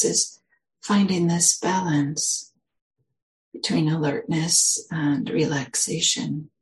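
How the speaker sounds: slow, measured meditation guidance with long pauses; guided meditation. Real, not acted. Meditation teacher settling listeners into their posture, guided meditation. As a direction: guide listeners gently into the body, unhurried.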